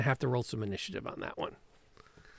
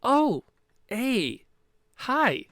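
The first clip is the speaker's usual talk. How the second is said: high, loud, startled innocent greeting; a rogue caught in the act, bluffing cheerfully; caught-out Petra; 11 semitones higher, 6 dB louder, much slower than usual. Acted. DM as Petra, caught by her crew, chirps loudly high. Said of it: "she quickly looks".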